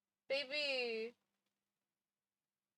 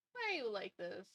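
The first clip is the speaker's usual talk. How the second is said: ordinary delivery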